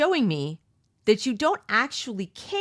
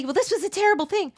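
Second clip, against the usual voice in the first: high, fast, loud mocking voice; mock naive young person; 12 semitones higher, 5 dB louder, faster than usual. Mimicking young people judging the past, high mocking voice. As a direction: sneer it like a preachy kid, pitched up. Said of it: "they'll say".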